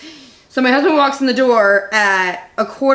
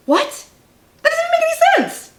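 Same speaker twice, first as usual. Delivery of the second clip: incredulous high "What?". Retold or imitated. Reacting to pop logic.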